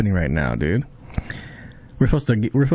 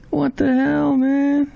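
stunned exclaim; much slower than usual. Real, not acted. Reacting to dramatic scene.

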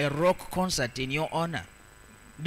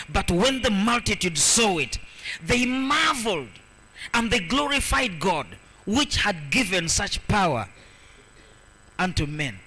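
raised, emphatic reading with a long, high key word; proclaimed Bible verse; 3 semitones higher, 4 dB louder than usual. Acted. Preacher reading verse eight to the congregation, proclaimed. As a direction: proclaim the verse, stretching the marvel.

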